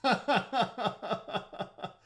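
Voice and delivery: high, loud laughing fit; real helpless laughter; burst of laughter. Real, not acted. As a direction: crack up in a long high laugh. Laughs at a chat message.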